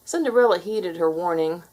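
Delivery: ordinary delivery, nothing marked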